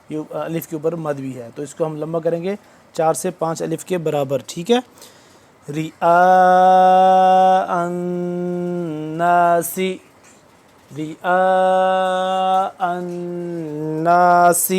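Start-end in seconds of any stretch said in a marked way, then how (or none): 5.7-14.7 رئاء stretched slowly, the آ held four to five counts; long madd on رئاء. Shown as a demonstration. Teacher recites to class: رئاء with the madd drawn out long. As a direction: draw out the vowel of رئاء very long. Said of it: "حمزہ الف مد زبر آ".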